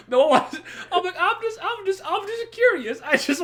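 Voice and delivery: pleading innocence while cracking up; laughing. Real, not acted. High laughing self-defence.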